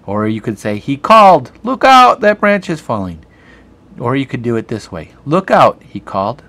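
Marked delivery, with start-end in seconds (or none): 0.8-3.2 loud, high excited call; a teacher dramatizing the example sentence; shouted warning. Shown as a demonstration. Teacher acts out the quote. 5.2-5.8 loud, high warning shout; a teacher acting out a shouted warning. Shown as a demonstration. Teacher acts out the quote.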